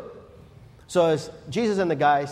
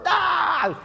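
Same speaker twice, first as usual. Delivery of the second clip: startled yelp; 13 semitones higher than usual. Acted. Peter sinking into water.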